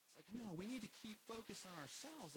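ordinary delivery

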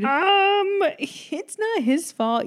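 sarcastic put-down in a high mock voice; mock sarcastic retort. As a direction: scoff at the prince like he's dim. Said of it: "And I'm like".

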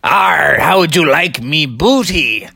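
growly pirate arr, playful; storyteller's mock pirate offering ice cream. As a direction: ham it up like a cartoon pirate. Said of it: "there was a pirate serving the ice cream".

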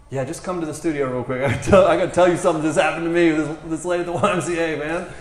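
acted eager phone invitation; excited news-sharing. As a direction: bubble over as you urge them to come. Excitedly telling caller his news.

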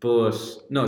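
usual speaking voice

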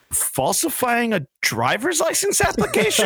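high-pitched, baffled judge reading a charge; incredulous. Retold or imitated. Judge reading out an absurd charge, incredulous. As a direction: climb high in mock disbelief.

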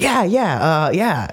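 excited agreeing, high jump then falling; eager yeahs tumbling down in pitch; Tom Cruise. Retold or imitated. Imitating how a movie star checks out of talk, eager yeahs. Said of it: "I think it's Tom Cruise".

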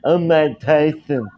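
high, slow put-on boxer voice; imitating Homeboy doing Mike Tyson; Mike Tyson impression. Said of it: "He's fucking funny".

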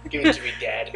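deadpan copy of her tone; same tone imitation. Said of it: "In that same exact tone that she had".

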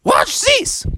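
squeaky, very high falsetto; high-pitched magical creature; Mariana the narwhal. Acted. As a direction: oblige him in a tiny sea-creature voice. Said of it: "And she said".

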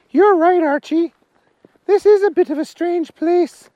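high, loud voice for Agnes. Acted. Agnes answering Archie about the small fella, high voice.

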